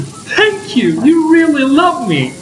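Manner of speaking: high delighted thank you; overjoyed, gush it out. Real, not acted.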